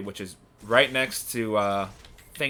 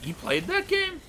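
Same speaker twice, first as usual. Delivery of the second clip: surprised. Real, not acted. Shocked friend played Epic Mickey.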